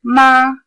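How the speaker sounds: "ma" held high, loud and level; first-tone "ma", high and unwavering. Shown as a demonstration. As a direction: say "ma" on one high flat note. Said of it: "The first tone".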